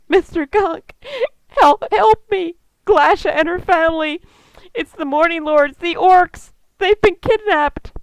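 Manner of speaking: high, loud, gasping plea; tearful, terrified. Acted. Elf woman reporting her friends kidnapped, tearful and terrified. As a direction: beg for help on the edge of tears. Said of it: "she is nearly in tears and looks terrified".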